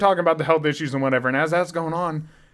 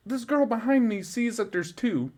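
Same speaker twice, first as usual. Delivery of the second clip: usual speaking voice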